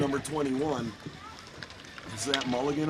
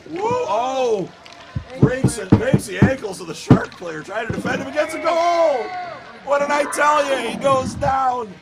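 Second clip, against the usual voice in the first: high, loud goal scream; excited shouted call; 13 semitones higher, 10 dB louder than usual. Real, not acted. Announcer calling an ankle-breaking goal, excited shouting. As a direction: shout it at the top of your voice.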